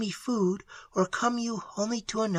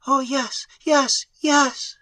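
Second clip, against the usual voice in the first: exulting shriek; 10 semitones higher, much slower than usual. Acted. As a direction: burst out in triumphant relief. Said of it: "with an exulting shriek".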